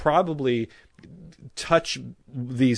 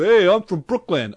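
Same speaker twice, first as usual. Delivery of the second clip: mocking Brooklyn guy; 8 semitones higher, 8 dB louder than usual. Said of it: "I hear that a lot".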